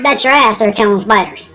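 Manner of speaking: normal talking voice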